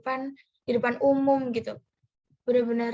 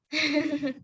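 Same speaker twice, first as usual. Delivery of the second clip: short real laugh; a brief amused chuckle; light chuckle; 3 semitones higher than usual. Real, not acted. Host unsure who should answer first, light chuckle.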